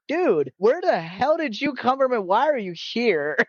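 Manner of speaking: mock bewilderment. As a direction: act out being baffled by the newcomer. Retelling baffled reaction to newcomer.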